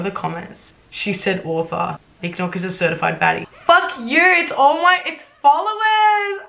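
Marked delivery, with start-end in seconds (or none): 3.5-6.4 high, drawn-out embarrassed yell; cringing outburst. Real, not acted. Writer cringing at her own old story, high embarrassed shriek.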